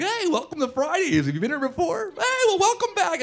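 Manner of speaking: very high, gushing mock greeting; over-enthusiastic server. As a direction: overdo the welcome, pitch soaring far up. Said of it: "the over-enthusiastic server".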